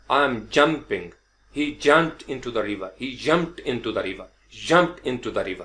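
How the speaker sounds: jumped said slowly with a soft j; slow demo sentence. Shown as a demonstration. Teacher modelling a sentence slowly for students. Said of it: "यालाबारी को थोड़ा शामिल कर लें".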